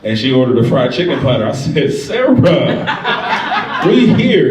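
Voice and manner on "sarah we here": delighted exclaim. Retold or imitated. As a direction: cheer her order like a win. Delight at her fried chicken order.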